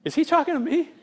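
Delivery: high-pitched, incredulous question; disbelieving onlooker. Acted. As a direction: play a crowd member who can't believe it. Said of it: "I'd be like this".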